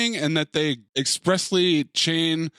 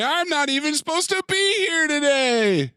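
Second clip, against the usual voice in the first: high, sing-song quote of the cliche; mock movie cliche, up high; 13 semitones higher than usual. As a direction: toss off the famous line in a high mock voice.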